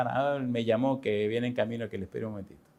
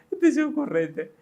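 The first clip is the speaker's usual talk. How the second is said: amused, pitched up high with a chuckle; amused boss chuckling at the clever young clerk; laughing; 11 semitones higher than usual. Retold or imitated. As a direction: tease him through a laugh. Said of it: "él se ríe también, como".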